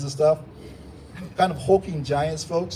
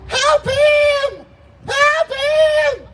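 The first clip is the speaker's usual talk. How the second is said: very high, piercing yell; shrieking shout; 7 dB louder, much slower than usual. Shown as a demonstration. Hype man yelling to ignite the crowd, shrieking. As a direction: shriek it out at full voice. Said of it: "shouting like that with a shrieking voice".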